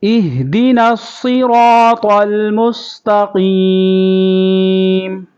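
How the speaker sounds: melodic teacher's tilawat; Quran recitation. Shown as a demonstration. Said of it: "میرے ساتھ دہرائیں آپ بھی۔".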